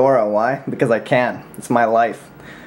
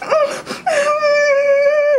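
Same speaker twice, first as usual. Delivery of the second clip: high, hammed-up crying wail; mock sob. Imitating Edwin breaking down on Skype, mock wail. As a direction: fake a bawling whimper.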